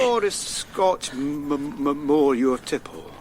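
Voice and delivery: stuttering on the first sounds; stuck on the m before the word; stammer. Acted. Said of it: "I know that stammer".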